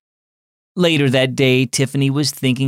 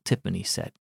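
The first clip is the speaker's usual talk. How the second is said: plain reading voice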